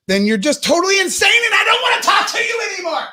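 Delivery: yelling, pitch shooting up; ranting shout. Real, not acted. Comic shouting at the uptight crowd, ranting. Comedian rants at audience.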